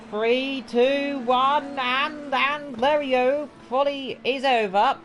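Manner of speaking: high, slowing countdown to the finish; excited countdown. Real, not acted. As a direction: count down the final seconds. Counting down the session end.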